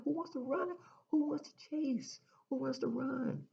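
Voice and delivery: high-pitched rhetorical disbelief; incredulous questioning. Acted. Speaker scoffing at chasing things, incredulous.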